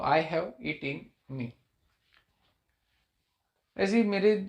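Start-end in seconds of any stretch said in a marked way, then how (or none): 0.0-1.5 "I have it in me" slowly, each word apart; separated, clear words. Shown as a demonstration. Teacher making the answer clear for students, word by word. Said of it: "बिल्कुल clear करने के लिए अलग-अलग".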